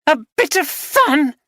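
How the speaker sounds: wife shouting back at husband; shouted. Acted. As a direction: throw his words back at him. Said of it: "she shouted".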